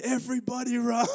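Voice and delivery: high-pitched imitated shout of alarm; retold yell. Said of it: "we just yell".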